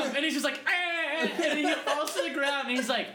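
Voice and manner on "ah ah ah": high yelps through laughter; hammed-up cries of someone collapsing; mock pained cries. Friend acting out robber being hit, mock yelps. Imitates man struck by pressure point.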